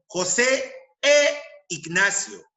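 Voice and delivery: "e" held long and set apart; stress on "e". Shown as a demonstration. Teacher showing e before words starting with I, "e" drawn out. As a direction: draw out the "e" before "Ignacio", slowly. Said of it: "prefiero usar E".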